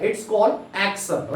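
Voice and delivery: very slow, word by word; slow definition. Shown as a demonstration. Teacher defining action for students, very slow and deliberate. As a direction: lay each word down slowly for the class.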